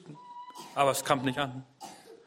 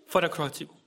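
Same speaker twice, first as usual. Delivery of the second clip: ordinary talk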